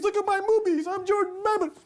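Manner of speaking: lampooning the chancellor in a high, silly voice; mocking silly voice. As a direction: ham up a ridiculous politician.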